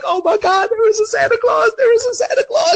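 put-on ecstatic kid. Kid reading the note from Santa, overjoyed squeal. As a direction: squeal it like a kid who believes again. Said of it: "I'm like".

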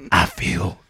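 slow, drawn-out mock tough guy; hammed-up grim hero, dragging every word; gritty American. Mocking a character who is all grit, slow grim drawl. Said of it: "He's just grit".

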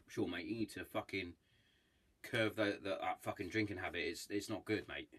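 low, soft, earnest brother's warning; quiet serious warning. Retold or imitated. Brother quietly telling a mate to curb his drinking, soft and earnest. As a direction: say it softly, meaning every word. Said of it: "turns up to me and says".

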